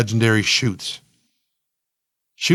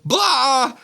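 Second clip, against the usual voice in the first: high, held "blah" on a big breath; nonsense yell; 13 semitones higher than usual. A narrator's reading. Narrator lets go after holding breath, high nonsense yell. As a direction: let out a high, silly "blah". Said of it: "take a big breath and hold it and go".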